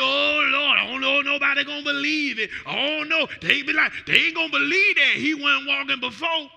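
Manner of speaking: loud high mock fretting; doubting churchgoers. Pastor mocking doubtful church folk, loud high mock fretting. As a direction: fret like a doubting member.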